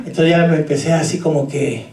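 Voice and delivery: usual speaking voice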